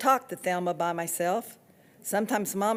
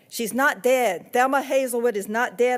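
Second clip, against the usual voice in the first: insisting loudly; 4 semitones higher, 6 dB louder than usual. A narrator's reading. Girl insists.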